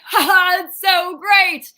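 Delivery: high, loud hammy TV host gush; Caesar Flickerman. Acted. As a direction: gush like an over-the-top talk-show host. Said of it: "my Caesar Flickerman moment where I'm like".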